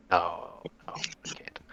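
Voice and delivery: whispered aside, no voice; hushed, breathy no. Real, not acted. Muttered aside to himself.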